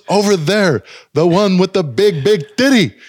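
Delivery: pressing the point emphatically; insistent repeat. Retold or imitated. Repeats it after a startled "What?.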